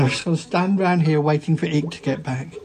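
ordinary talk

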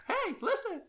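high squeaky fairy call; Navi voice. Retold or imitated. Quoting Navi's catchphrase.

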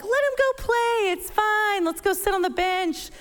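high, easy-going dismissive lilt; breezy free-range mom. Retold or imitated. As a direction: shrug off all worry. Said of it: "she's more of a free-range parent".

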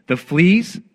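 storybook astonishment, rising sharply; incredulous. A narrator's reading. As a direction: balk at it, pitch shooting up. Corrie objects in the acted story.

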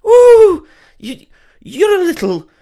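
high, loud put-on Welsh that drifts Scottish; hammed-up Welsh-turned-Scottish; attempted Welsh accent. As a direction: ham up a shrieking Welsh woman. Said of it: "Go on, do the accent".

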